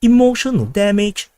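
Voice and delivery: put-on an Uncle Roger style American Chinese accent, punched out. Streamer granting a viewer's request, in an American Chinese accent. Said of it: "the American Chinese, the way they talk".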